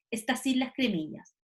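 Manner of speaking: usual speaking voice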